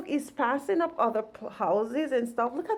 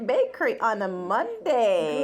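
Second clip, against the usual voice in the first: ordinary talk, nothing marked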